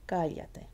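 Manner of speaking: "cállate" as CA-ya-te; first syllable of "cállate" strong, the rest falling; stress on CA. Shown as a demonstration. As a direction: lean on "cá" in "cállate". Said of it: "And now the ca".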